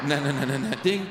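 real laugh running into the "no, no". Real, not acted. Laughs at own joke with kids.

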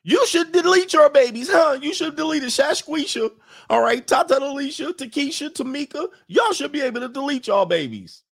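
mock high-pitched preachy voice. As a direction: squeal it out like a mocking caricature. Mocking voice of abortion advocates.

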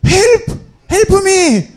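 high-pitched acted-out cries to flag down a car; calling for help. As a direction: cry out one word at a time, replaying a desperate call. Flagging down German crew for help. (도와달라고 높게 외치는 흉내)

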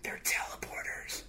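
hushed conspiratorial whisper. Acted. A secret to the co-host, whispered. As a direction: breathe it like a secret.

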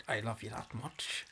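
slow, soft slip into Irish; low, quiet Irish accent; accidental Irish. Acted. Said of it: "I went Irish there".

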